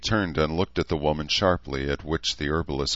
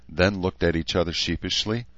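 ordinary delivery

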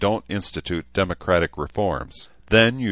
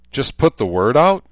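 higher, louder, rising disbelief; incredulous question; 9 semitones higher, 8 dB louder than usual. A narrator's reading. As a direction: challenge him, you can't be serious. Daughter incredulous at father.

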